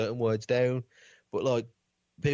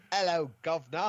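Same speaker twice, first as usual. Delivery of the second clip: mock Cockney, pitched up; hammed-up fake Cockney greeting; terrible Cockney accent; 5 semitones higher than usual. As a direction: imitate tourists badly faking Cockney. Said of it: "a really terrible Cockney accent".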